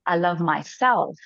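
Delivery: slow build onto a weighted "myself"; slow affirmation landing hard on "myself"; stress on "myself". Shown as a demonstration. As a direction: lean on "myself" and let it fall. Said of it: "put emphasis on different words".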